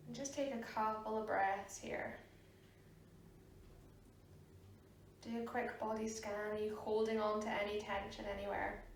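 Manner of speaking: soft, unhurried meditation voice with long pauses; guided meditation. Real, not acted. Yoga teacher guiding a class to sit and breathe, soft meditation voice.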